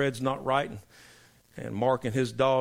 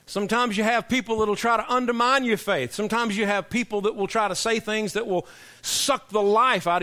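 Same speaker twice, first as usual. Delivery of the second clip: raised, louder pulpit voice, driving the point home; preaching at full force; 8 semitones higher, 5 dB louder than usual. Acted. Preacher warning the church about faith-draining people, louder and raised. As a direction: preach it hard to the congregation.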